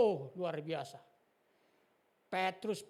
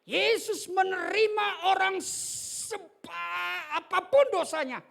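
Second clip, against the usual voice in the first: slow, much higher and louder proclamation; preaching shout; 10 semitones higher, 9 dB louder, slower than usual. Real, not acted. Preacher proclaiming Jesus's acceptance, slow loud shout. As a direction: stretch every word out over the crowd.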